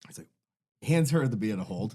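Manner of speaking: ordinary talk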